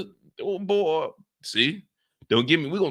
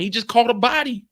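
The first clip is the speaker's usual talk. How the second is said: ordinary talk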